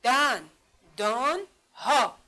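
دن، دان، ها in three slow, separated beats; firm teacher's syllable count on دن، دان، ها. Shown as a demonstration. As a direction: split it into دن، دان، ها with clear pauses. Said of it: "چند بخش شد؟".

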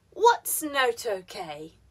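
slow, loud "What's", drop through "okay"; falling question intonation. Shown as a demonstration. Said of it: "falling intonation with W H and open".

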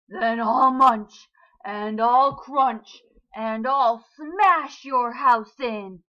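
loud, slow roaring shark. A narrator's reading. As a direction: roar each threat slowly. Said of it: "roared the shark".

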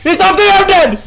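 high, panicked call; alarmed cry. Real, not acted. Player seeing the killer above, high alarmed cry. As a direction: cry out, sure of being caught.

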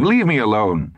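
testy retort, mildly angry. A narrator's reading. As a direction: brush off a nag crossly. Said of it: "he said angrily".

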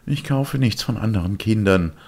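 put-on high, know-it-all kid; a cocky boy brushing him off; smug boyish voice; the boy's voice. Boy refuses.

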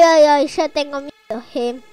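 ordinary delivery

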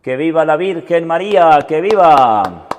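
joyful acclamation. Real, not acted. Aclamación a la Virgen.

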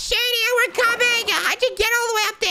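high-pitched yell to a friend; high, urgent shout upward; excited calling out. Pretend. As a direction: call up high and strained.

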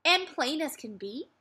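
ordinary delivery

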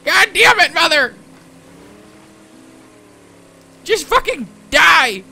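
loud, exasperated son yelling at mother; angry outburst. Pretend. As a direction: snap loudly in a mock family fight. Acted quarrel with his mother.

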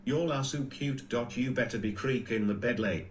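ordinary talk, nothing marked

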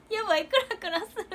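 laughing dizzy quote. Retold or imitated. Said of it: "貧血になってた".

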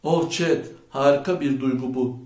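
ordinary delivery

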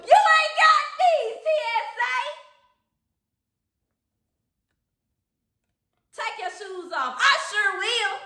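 high, loud, slow bragging to security; loud high mock brag; hyped playacting. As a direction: flex on the TSA agent. Imagined airport TSA exchange.

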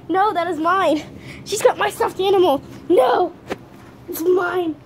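high, loud playful "no, mine"; fake-indignant at the thieving dog; mock protest. Real, not acted. Owner protesting as dog steals toy, playful loud.

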